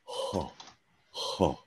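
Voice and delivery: soft, airy huh like fogging glasses; breathy open huh. Shown as a demonstration. Coach showing Gary breath on a mirror, soft breathy huh. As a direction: exhale an open-throated huh onto a mirror. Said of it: "you go".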